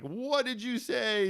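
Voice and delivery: high, slow, hammed-up disbelief; mock outrage. Dad playfully shocked at his daughter's joke, mock outraged. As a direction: pretend to be shocked by the cheeky insult.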